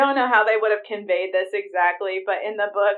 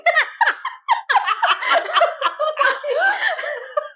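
a long, high helpless cackle; long high laugh. Real, not acted. Big laugh after her reaction.

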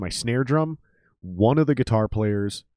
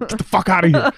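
mock outburst; 12 semitones higher, 6 dB louder than usual. Mock reaction to "chill out.

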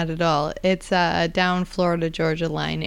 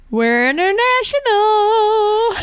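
excited announcement; 14 semitones higher, 5 dB louder, slower than usual. Acted. Grand announcement.

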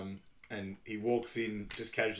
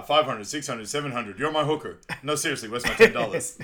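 loud, punchy retold demand; mock haggling; 3 semitones higher, 9 dB louder than usual. Film character demands his ten dollars, loud mock haggling. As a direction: play up the haggling at rising volume.

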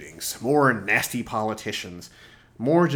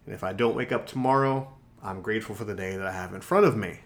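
normal talking voice

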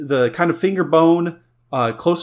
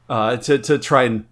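usual speaking voice

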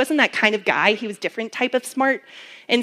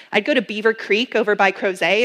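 usual speaking voice

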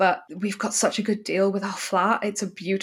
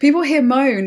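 louder and higher on "People"; emphatic; 6 semitones higher, 8 dB louder than usual. Real, not acted. Telling a friend about German train complaints, emphatic start. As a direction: punch "People" to launch the gripe.

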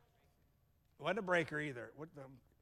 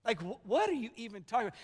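acted hurt disbelief, pitch raised; wounded and offended; 3 semitones higher than usual. Joseph to the brothers who sold him, wounded and indignant. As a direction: protest as the betrayed benefactor, building in force. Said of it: "He was so wounded and offended".

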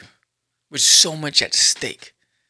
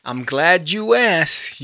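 ordinary talk

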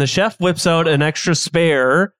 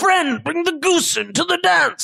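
higher, shouted chef voice; chef calling out; 9 semitones higher than usual. Acted. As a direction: chef calls across the kitchen. Chef shouts urging helper.

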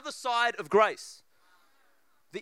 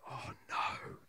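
dread; 13 dB quieter than usual. Retold or imitated. Dreading the friend at door.

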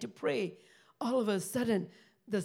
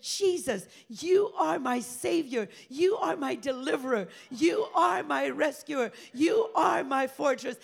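loud tearful praise; 3 semitones higher, 5 dB louder than usual. Retold or imitated. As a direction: cry out each "you are" as a tearful declaration. Freed woman praising Jesus aloud.

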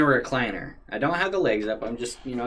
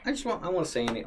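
usual speaking voice

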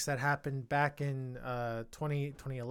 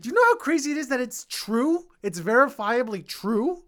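excited shout; 8 semitones higher, 9 dB louder than usual. Real, not acted. Keeps raving, overexcited.

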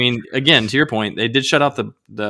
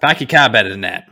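put-on Boston accent; 5 dB louder than usual. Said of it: "Park the car".

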